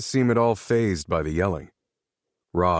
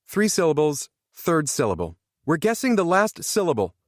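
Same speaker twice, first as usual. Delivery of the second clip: raised, high-pitched competitive instructions; yelling; 10 semitones higher than usual. A narrator's reading. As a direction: call out to the whole group, pitched up. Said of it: "she yelled at all of us".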